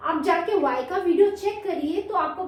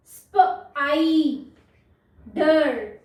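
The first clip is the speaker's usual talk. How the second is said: Spider very slowly, broken into sound parts; 3 dB louder, much slower than usual. Shown as a demonstration. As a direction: stretch spider out piece by piece: sp, eye, der. (Spider को तोड़-तोड़कर, बहुत धीरे)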